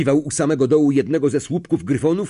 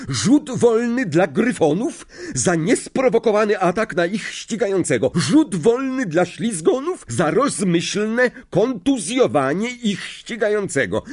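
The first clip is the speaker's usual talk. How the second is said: much higher, stern referee ruling; 9 semitones higher than usual. Acted. Madam Hooch to both teams, stern ruling. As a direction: rule on both fouls firmly.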